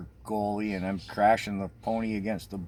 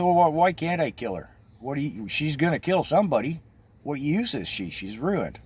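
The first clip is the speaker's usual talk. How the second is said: louder, higher angry questions; enraged; 6 semitones higher, 3 dB louder than usual. Retold or imitated. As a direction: fire back in a rage, fading at the end. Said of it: "I'm enraged".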